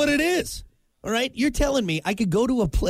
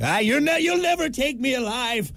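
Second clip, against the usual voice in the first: stubborn grandma voice; 6 semitones higher than usual. Retold or imitated. Grandma refusing the nursing home.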